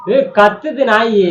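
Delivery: loud, slow holler; irritated yell. Real, not acted. Husband pointing at the howling dog, irritated yell. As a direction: holler it out, stretching the words. Husband yells over wife's complaining.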